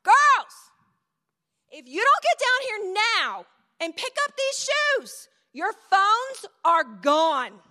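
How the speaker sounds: put-on Girls shouted high and very loud, then a slow, loud threat about the phones; shouting upstairs. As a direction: yell the final warning up the stairs. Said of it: "I say it a little bit louder".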